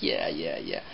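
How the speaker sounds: low, soft, brushed-off yeah yeah yeah; dismissive parents. Retold or imitated. Parents humoring their son about the bear, bored and dismissive. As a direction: humor the kid without believing him. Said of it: "the parents are like".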